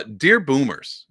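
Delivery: slow, loud formal greeting; slow mock-formal salutation; dramatic reading. Shown as a demonstration. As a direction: announce it like a letter opening. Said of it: "I like to put emphasis".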